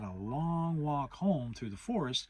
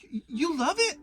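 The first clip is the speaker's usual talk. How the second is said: much higher stammering little voice; Piglet, small and timid; 7 dB louder than usual. Acted. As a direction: squeak it out as tiny Piglet. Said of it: "said Piglet".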